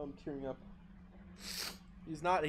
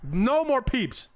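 no more peeps, dragged out, high and loud; slow shout; 12 semitones higher, 12 dB louder, much slower than usual. Pretend. Angry teacher shouting at the noisy class. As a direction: bellow the final warning slowly. Teacher bit, final warning.

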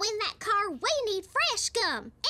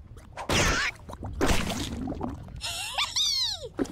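long high villain cackle; evil laugh; 11 semitones higher than usual. Acted. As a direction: cackle in triumph. Laughs after kicking rival.

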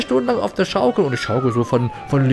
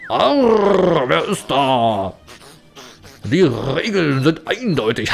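pirate voice; much slower than usual. Pretend. Pirate captain voice in game.

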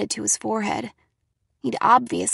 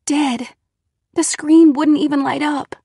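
higher, louder, alarmed narration; tense alarm; 6 semitones higher, 7 dB louder than usual. A narrator's reading. Girl finds her phone dead, tense alarm. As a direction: punch out the bad news in alarm.

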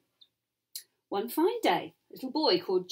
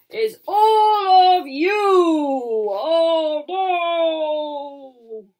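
troll voice; 5 semitones higher, 9 dB louder, much slower than usual. A narrator's reading. Troll threatening.

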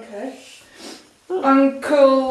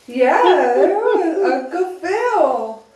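words through real laughter; giggling reply; laughing; 4 semitones higher, 4 dB louder than usual. Real, not acted. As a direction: laugh while you say it. Parent laughing at child's answer.

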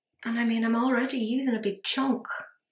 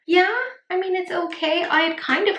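yeah pitched higher and louder, forcing cheer; forced brightness; 9 semitones higher, 6 dB louder than usual. Real, not acted. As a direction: pretend everything is fine, brightly. Forcing calm after panic.

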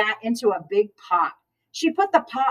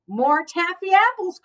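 high-pitched call for more; 8 semitones higher than usual. A narrator's reading. Mister Rogers asking for more taffy apples, calling out. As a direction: call it out brightly pitched, without shouting. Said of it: "called Mister Rogers".

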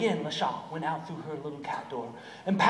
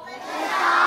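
shouted repeat; 9 dB louder than usual. Real, not acted. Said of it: "Can you say Le Chat?".

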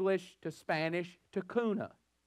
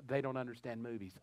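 softer and lower, a hushed aside; retold whisper; 5 semitones lower, 8 dB quieter than usual. Father to his children at the movie, hushed aside. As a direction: lean in and murmur it. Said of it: "I get a whisper in their ear".

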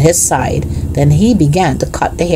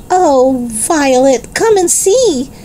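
calling out; 10 semitones higher, slower than usual. A narrator's reading. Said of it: "she called".

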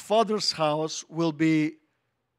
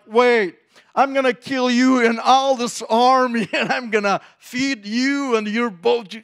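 high, eager retort breaking into a laugh; the boy David answering Goliath with a laugh; David's fiery comeback; 5 semitones higher, 5 dB louder than usual. Retold or imitated. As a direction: fire it straight back at the giant. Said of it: "David like".

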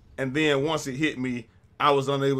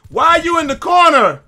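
high, loud exasperated yell; yelling at enemy; 14 semitones higher, 13 dB louder than usual. Real, not acted. Gamer yelling at a camping enemy. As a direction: yell it at the screen.

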